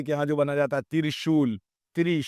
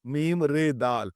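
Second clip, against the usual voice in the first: letter by letter, slow; slow classroom spelling; spelled out slowly; slower than usual. Shown as a demonstration. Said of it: "تو ہم جب بولے مرد،".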